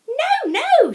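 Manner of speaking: high loud frantic mum; alarmed mum crying no. A narrator's reading. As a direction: shriek it like a panicked mother. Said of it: "she cried".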